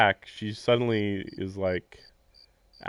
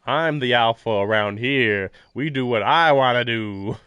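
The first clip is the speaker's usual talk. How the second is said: slow, loud, puffed-up tough-guy voice; mock alpha boast; 7 dB louder, slower than usual. Mocking Shiki's new attitude, a slow, loud alpha swagger. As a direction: strut through it like a swaggering boss. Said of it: "He's like".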